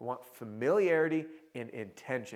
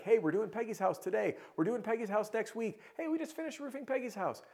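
bright salesy greeting; 10 semitones higher than usual. Retold or imitated.

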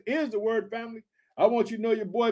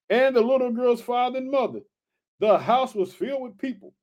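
ordinary talk

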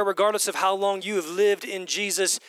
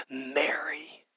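whispered, much softer name; tender whisper; 3 semitones lower, 7 dB quieter than usual. Acted. Jesus speaking Mary's name at the tomb, whispered. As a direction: call her name gently, barely voiced. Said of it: "He says her name".

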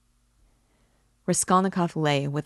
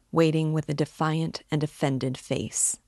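ordinary delivery

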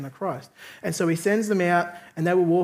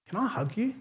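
soft, higher, imitated tearful plea; tearful request; 4 semitones higher, 6 dB quieter than usual. Weeping stranger asks his guest for comfort, softly tearful. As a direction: ask timidly, as if lightly mimicking someone weeping. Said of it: "he starts crying".

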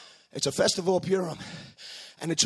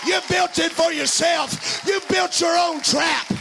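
preaching; 10 semitones higher than usual. Acted. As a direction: preach it out, full force. Preaching at full force, curse.

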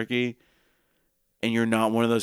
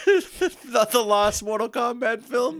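high silly voice; 12 semitones higher, slower than usual. Acted. Joke movie title voice.

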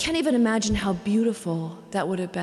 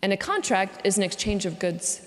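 ordinary talk, nothing marked

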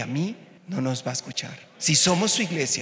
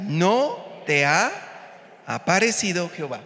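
slow scripture reading; 3 semitones higher, slower than usual. Acted. Scripture read slowly.

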